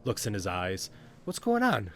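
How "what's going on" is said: high-pitched, loud demand; demanding Corky. Acted. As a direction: insist on an explanation in a high little voice.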